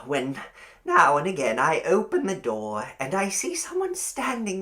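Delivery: put-on high, sweet old landlady, fussing and eager. A narrator's reading. Landlady welcoming her new young lodger, high and sweetly eager. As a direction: coo it like a doting landlady.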